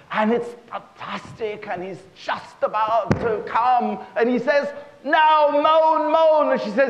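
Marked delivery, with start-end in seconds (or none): 5.0-6.5 high, loud climactic cry; hammed-up ecstatic shout; urgent command. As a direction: demand it frantically, drawn out. Husband urges at the climax.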